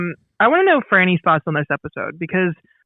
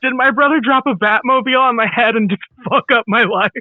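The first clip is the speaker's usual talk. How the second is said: higher-pitched comic alarm, retold; shocked realization; 6 semitones higher, 3 dB louder than usual. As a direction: blurt out a mock-horrified realization. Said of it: "And I was like".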